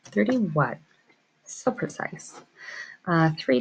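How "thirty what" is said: normal talking voice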